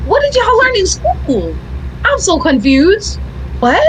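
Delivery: high, incredulous scolding; baffled at teachers who don't know; exasperated disbelief. Retold or imitated. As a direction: rant in mock outrage. Retold rant at teachers.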